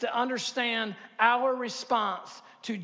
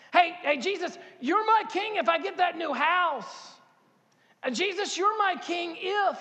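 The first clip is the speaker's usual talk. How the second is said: higher, insistent bargaining with Jesus; a churchgoer making deals with God, pitched higher; conditional prayer voice; 6 semitones higher than usual. Retold or imitated. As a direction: raise the pitch and plead your terms. Voicing people's conditional prayers.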